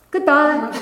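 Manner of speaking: high, laughing little goodbye; deva's mock goodbye. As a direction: sing it out playfully as the spirit leaves. Deva vanishes, retold playfully.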